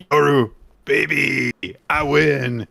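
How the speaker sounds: loud, high, smug taunt; cocky shout. Acted. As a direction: gloat loudly at her. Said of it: "he says".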